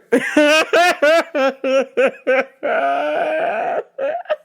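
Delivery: long high-pitched cackling fit; big uncontrolled belly laugh; helpless laughter. Real, not acted. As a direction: laugh hard until out of air. Cracks up at own story.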